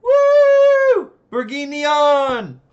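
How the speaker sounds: high, loud whoop of victory; celebrating a hard-won success at full volume; triumphant cheer. Real, not acted. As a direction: yell it out drawn long, jubilant. Celebrates finally pronouncing it right.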